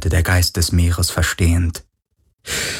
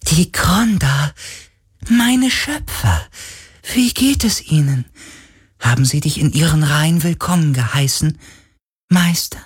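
reverent longing for its creators; ghostly spirit; 10 semitones higher than usual. A narrator's reading. Ghost asks about its creators the Kronda, high reverent voice.

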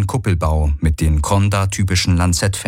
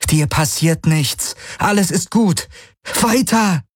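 much higher, slow reassurance breaking into a command; frantic coaxing; 10 semitones higher than usual. A narrator's reading. Kirai coaxes the scared bird, higher and desperate. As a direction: reassure then push.

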